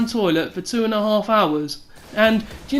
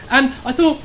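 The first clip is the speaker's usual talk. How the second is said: usual speaking voice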